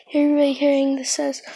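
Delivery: usual speaking voice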